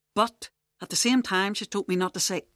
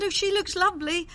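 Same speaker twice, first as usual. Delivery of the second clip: gushing repeat; 8 semitones higher than usual. Retold or imitated. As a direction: replay the gushing compliment pitched well up. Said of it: "That's what I said".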